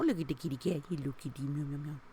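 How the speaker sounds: ordinary delivery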